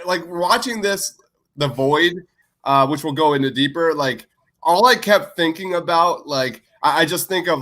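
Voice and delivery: usual speaking voice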